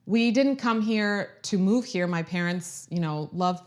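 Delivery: ordinary talk, nothing marked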